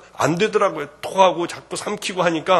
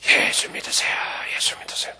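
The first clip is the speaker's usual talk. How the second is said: unvoiced whisper with no sound coming out; whispering after losing the voice; voiceless whisper. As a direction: try to preach with a voice that won't come. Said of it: "소리가 나와야죠".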